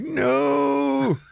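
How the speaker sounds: long high-pitched drawn-out no; high comic cry of refusal; mock horrified cry. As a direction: wail it out in exaggerated horror. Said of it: "he's like".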